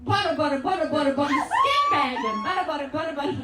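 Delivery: excited imitated toddler chant; toddler imitation. Dad retelling his son's shower antics, toddler imitation. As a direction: chatter it fast and silly like a little boy. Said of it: "looked up at Daddy and just went".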